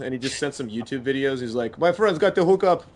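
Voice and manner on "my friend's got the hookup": high, loud put-on Trinidadian accent; imitating a Trinidadian friend's accent; Trinidadian accent impression. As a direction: brag about the float in a Trinidadian accent. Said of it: "when I try to do an Island Times accent".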